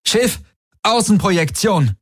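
high, commanding call; urgent command. A narrator's reading. Tari ordering the ship to show outside, urgent command. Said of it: "rief Tari".

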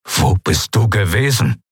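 tense, demanding question; barely contained turmoil. A narrator's reading. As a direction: demand an answer with contained anger. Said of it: "seinen inneren Aufruhr zu bändigen".

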